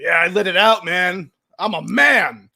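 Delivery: loud, triumphant quote of a man; liberated shout. Retold or imitated. Imitating a man who let it out, liberated shout. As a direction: belt it out as a man set free. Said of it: "he's liberated. He's happy".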